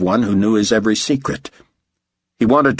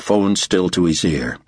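ordinary delivery, nothing marked

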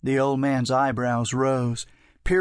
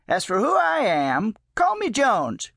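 put-on much higher, grand old man; 8 semitones higher than usual. A narrator's reading. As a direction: announce yourself with flair. Old man introduces himself.

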